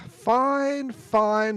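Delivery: high, loud, exasperated sigh into words; exasperated giving in. Acted. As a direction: give in, thoroughly fed up. Prisoner gives in to angry guard.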